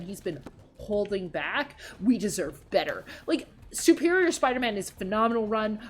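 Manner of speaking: normal talking voice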